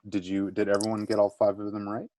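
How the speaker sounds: ordinary delivery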